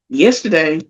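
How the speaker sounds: usual speaking voice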